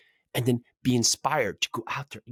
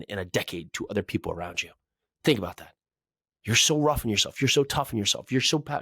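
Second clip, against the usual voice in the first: usual speaking voice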